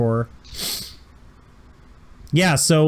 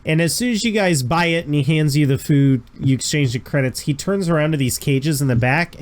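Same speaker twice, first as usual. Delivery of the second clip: normal talking voice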